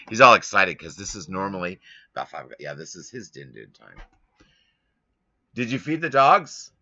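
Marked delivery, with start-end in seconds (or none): 5.5-6.7 calling out. Real, not acted. Calling to employee in back.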